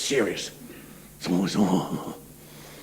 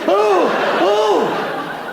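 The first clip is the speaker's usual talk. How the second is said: much higher hammed-up "oh, oh" moans; mock orgasm moans; 11 semitones higher than usual. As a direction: ham up the agonised lovemaking moans. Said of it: "They sound like they're in bloody agony".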